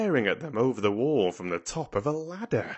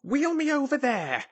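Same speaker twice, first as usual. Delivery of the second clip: put-on high, commanding boy's voice; imperious command; 3 dB louder than usual. A narrator's reading. Colin ordering Dickon to wheel him, imperious command. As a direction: order it imperiously, pitched right up. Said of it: "he commanded".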